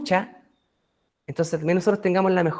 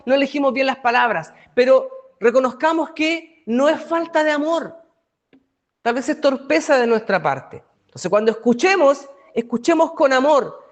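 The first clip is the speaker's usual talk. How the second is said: preaching; 6 semitones higher than usual. Acted. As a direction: press the lesson home from the pulpit. Predicación con fuerza. (predicación alta y pausada)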